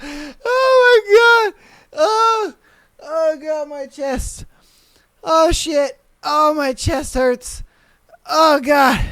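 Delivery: high wailing laughter, gasping oh my God; laughing fit. Real, not acted. Streamer laughing at a viewer's story, high wailing. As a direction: laugh till it hurts, voice cracking high. Laughing so hard chest hurts.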